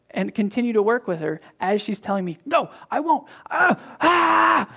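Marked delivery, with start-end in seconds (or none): 2.4-4.7 put-on high, loud screaming little girl; tantrum child. Daughter screaming her refusal at her dad, shrill tantrum.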